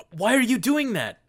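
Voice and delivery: high, loud outraged shriek; shrieking outrage. Acted. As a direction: scream at them in shock. Gwendola confronts Ian.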